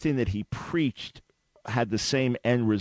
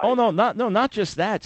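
surprised denial; 10 semitones higher, 3 dB louder than usual. Real, not acted. Emphatic denial of opponent's guess.

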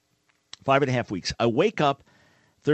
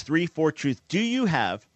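ordinary delivery

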